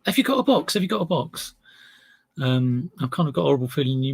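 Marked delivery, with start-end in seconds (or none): 0.0-1.5 imitating buyers badgering for a box; mock pestering buyer. Seller mimicking a buyer's repeated box request, high and nagging. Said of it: "other people saying".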